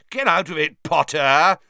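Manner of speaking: snapping yell at a rival; frustrated yell. A narrator's reading. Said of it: "Malfoy yelled in frustration".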